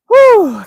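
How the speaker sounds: high, loud puff of relief; breath let out after a workout; loud exerted whew. Real, not acted. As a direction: blow out a big whew.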